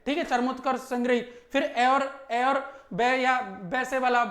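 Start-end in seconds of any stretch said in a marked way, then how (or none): none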